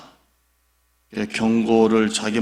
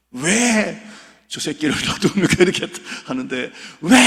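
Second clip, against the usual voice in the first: high, rough outburst at God; more aggressive; 6 semitones higher than usual. Retold or imitated. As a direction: blurt it out indignantly. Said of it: "조금 더 과격하게". (하나님께 과격하게 항의하는 목사)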